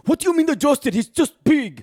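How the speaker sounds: high, loud imitated outburst; exasperated high-pitched mock yell; flipping out. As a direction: mimic a man losing it over his tie. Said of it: "And he's flipping out".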